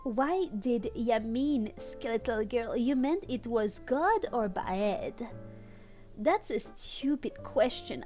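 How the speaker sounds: ordinary talk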